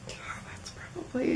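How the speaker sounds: barely voiced, slow thinking aloud; whispering. Real, not acted. Thinking over which party story to tell, whispering. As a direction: whisper it slowly to yourself.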